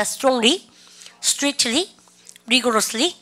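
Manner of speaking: strongly, strictly, rigorously, each word set apart with a rising end; repeat-after-me drill. Shown as a demonstration. Teacher has students repeat strongly, strictly, rigorously, rising drill delivery. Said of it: "쌤하고 한번 다시 따라해 보도록".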